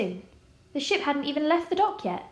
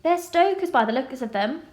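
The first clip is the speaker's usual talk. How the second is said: dismissive; 3 semitones higher, 3 dB louder than usual. A narrator's reading.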